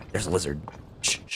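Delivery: quiet shushing so the lizard won't run; hushed. Real, not acted. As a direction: drop to a hush to avoid startling it. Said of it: "I shut up in real life too".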